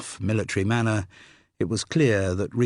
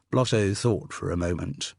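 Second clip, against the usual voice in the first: ordinary delivery, nothing marked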